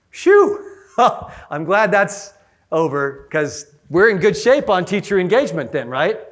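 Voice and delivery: put-on sigh of relief, tongue in cheek; mock relief. Mock relief, joking with audience.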